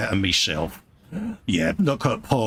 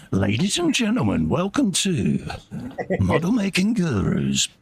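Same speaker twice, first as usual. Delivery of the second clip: mock announcer; 6 semitones higher than usual. Host teasing friend with a mock game-show announcement.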